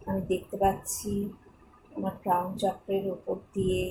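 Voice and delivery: guided meditation. Acted. Guide leads listeners in thanks and divine healing, meditative. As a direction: lead listeners gently through gratitude and prayer, leaving space.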